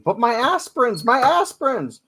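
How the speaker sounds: put-on high, loud shrieking old woman; screaming old lady. Old lady on the bus screaming at the driver for her pills. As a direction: shriek it like a desperate granny. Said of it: "starts screaming".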